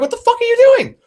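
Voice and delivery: very high, loud incredulous yell; outraged protest. Retold or imitated. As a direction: re-enact a shocked, angry protest. Retold protest while things are thrown.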